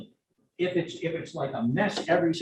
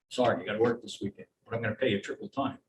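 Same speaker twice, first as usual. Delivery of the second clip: lower, quick mock boss; low, fast boss voice; 6 semitones lower, 7 dB quieter, faster than usual. Boss offering triple pay to an employee, low and fast. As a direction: rattle it off low like a boss making a deal.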